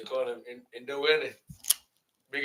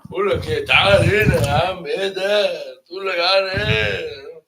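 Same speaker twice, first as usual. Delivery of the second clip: loud, raised-pitch Egyptian Arabic; big Egyptian voice; 5 semitones higher, 12 dB louder than usual. Shown as a demonstration. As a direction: call out demandingly like a loud Egyptian. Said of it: "It's a big voice".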